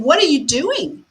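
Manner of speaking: half-hearted yell, pitch jumping up; mock yelling. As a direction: act out a sudden outburst, held back. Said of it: "you start yelling".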